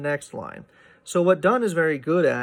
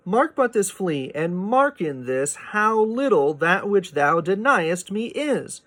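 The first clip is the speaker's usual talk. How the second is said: higher, slow recitation through the line break; poem reading; 5 semitones higher than usual. Shown as a demonstration. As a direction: carry the verse on to the end stop. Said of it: "see there".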